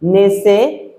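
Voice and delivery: usual speaking voice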